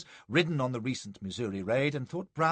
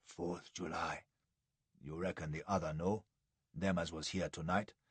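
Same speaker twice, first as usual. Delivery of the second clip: whispering; 6 semitones lower, 9 dB quieter than usual. A narrator's reading. Joe squatting by the narrator, whispering secretly. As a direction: breathe it close and secret.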